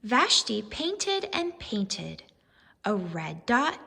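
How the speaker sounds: normal talking voice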